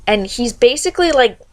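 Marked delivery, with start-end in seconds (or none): none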